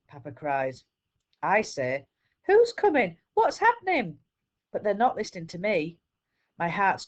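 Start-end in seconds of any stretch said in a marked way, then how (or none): 2.4-4.2 high, loud and panicky; a scared little boy begging to know; a child's alarmed cry to his parents; frightened child. Acted.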